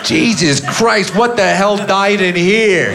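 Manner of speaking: high, slow, drawn-out Nicholson-style disgust; Jack Nicholson. Retold or imitated. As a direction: ham up a horrified Jack Nicholson. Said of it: "and he goes".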